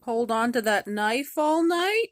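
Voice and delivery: put-on a nervous little girl asking the robber girl; a hint of a wary young girl; young Gerda.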